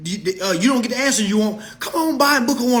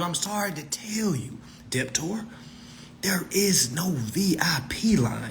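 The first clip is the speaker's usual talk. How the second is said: whisper; 3 semitones lower, 9 dB quieter than usual. Real, not acted. Breaking the bad news softly.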